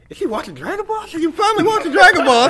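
mock surprise. Fan reacts to roommate's cartoon, high and incredulous. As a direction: act out amazed disbelief. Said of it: "I was like".

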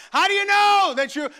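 high, loud rhetorical cry; preaching shout. Real, not acted. Preacher crying out the question to the congregation, loud and high. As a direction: drive the question home.

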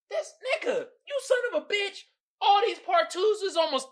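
high, pained exasperated wail. Real, not acted. Vlogger fuming over album length, high pained wail. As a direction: cry out in mock despair.

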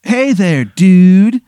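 high, loud laid-back surfer greeting; surfer dude voice. Acted. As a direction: greet them like a stoned beach god. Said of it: "he goes like".

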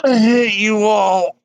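slow, loud grumpy groan. Pretend. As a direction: drag it out like you just woke up. Late arrival, just woken up.

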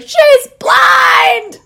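high, loud laughing shout; screaming she's blind at a dog; retold yell. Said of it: "yelling as I left the dog park".

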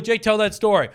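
high, loud mock prompting; mock a heckler egging someone on; mocking quote.

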